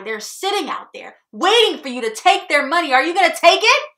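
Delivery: full-volume, high, hectoring tirade; shouted harangue. Acted. Boss goading salesmen to take the money, shouting. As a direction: yell it to drive them out selling.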